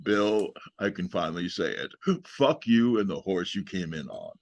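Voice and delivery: deep, low, deliberate mock-solemn voice; George Takei as Sulu. Guest retells Takei's roast of Shatner, best Sulu impression. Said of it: "this is my best Sulu impression".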